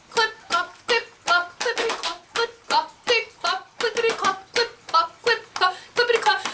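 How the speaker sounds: brisk rhythmic clip-clop; trotting hoofbeats. A narrator's reading. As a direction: make hooves with the voice, steady and bouncy. Faster hoofbeats in story.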